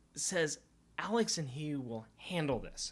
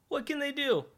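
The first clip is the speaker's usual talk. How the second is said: defiant high voice; 13 semitones higher, 9 dB louder than usual. Acted. Horseboy's defiant quote.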